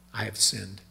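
quiet confession. Retold or imitated. David confessing to Nathan, quiet and humbled. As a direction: say it low, ashamed and broken.

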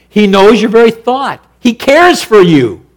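loud, high ringing assurance; full-force preaching. Acted. Preacher encouraging the congregation, full-force preaching. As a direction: lift it up and proclaim it. Preacher driving the point home.

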